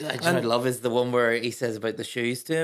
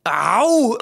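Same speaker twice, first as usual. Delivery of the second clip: imitated outraged ow; 6 semitones higher, 8 dB louder than usual. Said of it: "he just goes".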